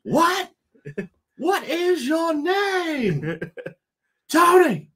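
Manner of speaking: very high, loud, drawn-out holler; imitated viral-video yell. Co-host imitating a video that goes around, high hollering. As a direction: stretch it out in a high mock shout. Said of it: "what's that video that, that goes around?".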